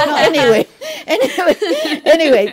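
real laugh breaking through the words. Real, not acted. Laughing at her own joke.

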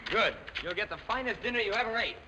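ordinary talk

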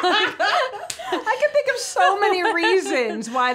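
laughing as he speaks; slow laughing reply. Real, not acted. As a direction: speak through a laugh, slowly.

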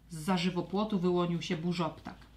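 plain reading voice

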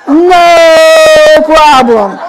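drawn-out words through real laughter; chuckling no problem; laughing. Real, not acted. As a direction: laugh as you say it, very slowly. Laughing recalling the win.

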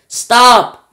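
high, very loud shout; full-voiced alarmed yell. A narrator's reading. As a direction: yell it out to halt everything. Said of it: "yelled Grandma Josephine".

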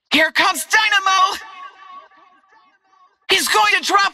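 very high-pitched urgent yells; combat shouting. Acted. Fighter warning allies and calling for help, high urgent shouting. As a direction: shout warnings across a battle.

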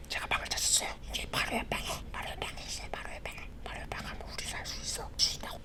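hushed, secretive whisper. Shown as a demonstration. Quietly telling grandma of the room next door, whispering. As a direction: whisper it fast and very softly. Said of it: "이렇게 하면 되는데".

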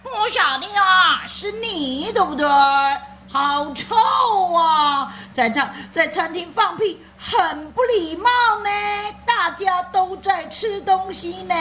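high-pitched scolding grandma voice; grandma fussing over manners at dinner; angry grandma. Acted. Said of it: "奶奶就生气了".

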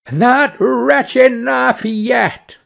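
high, slow taunt; storybook bird's vengeful retort; taunting sparrow. A narrator's reading. Sparrow taunts the carter again.

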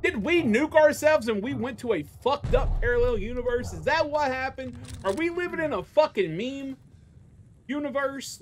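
high, disbelieving and sarcastic; incredulous rant. Real, not acted. Venting to chat in disbelief, incredulous. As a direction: ask it like the world has gone mad.

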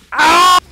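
sudden very loud, high scream; startled scream. Real, not acted. Startled during the game.

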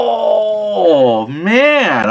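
real disappointed outcry; dismayed cry. Real, not acted. Bad die roll result.

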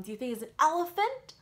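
usual speaking voice

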